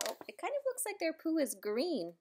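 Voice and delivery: ordinary delivery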